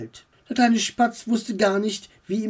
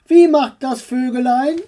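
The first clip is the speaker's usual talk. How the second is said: higher, louder coaxing call; 7 semitones higher, 6 dB louder than usual. Acted. Uncle calling up to flapping Max, raised. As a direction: call up to him encouragingly. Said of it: "rief ihm sein Onkel zu".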